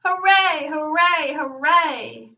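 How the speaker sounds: loud hoorays, starting high and easing down; shouted cheers. A narrator's reading. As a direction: cheer loudest of the whole crowd. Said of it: "shouting the loudest".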